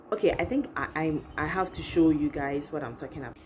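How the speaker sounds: usual speaking voice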